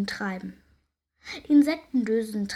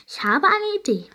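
fairy queen; 9 semitones higher, 6 dB louder than usual. A narrator's reading. Queen announces idea.

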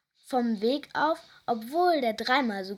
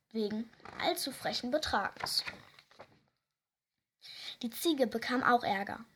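plain reading voice

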